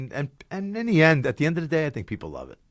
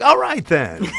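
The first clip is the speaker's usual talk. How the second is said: higher, louder breezy brush-off; brightly gloss over the unimpressed guest; glazed-over cheer; 5 semitones higher, 5 dB louder, slower than usual. Acted. Performer brushing off a grumpy guest, glazed-over cheer. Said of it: "glaze over them, like".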